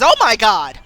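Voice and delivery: loud, shocked cry of fright; terrified cry. Acted. Miranda facing the lurching zombie, loud terrified cry. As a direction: yell it as the zombie appears.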